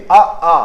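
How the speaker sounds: अ then a loud, open आ from the throat; vowel demo. Shown as a demonstration. Teacher demonstrates the throat vowels अ and आ to pupils, loud and open. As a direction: open the throat and sound आ out fully. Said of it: "जब आ और बड़ा आ बोलते हो".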